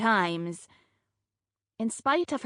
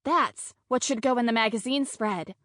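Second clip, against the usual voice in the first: ordinary delivery, nothing marked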